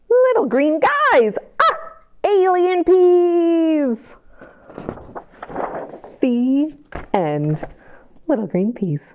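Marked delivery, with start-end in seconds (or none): none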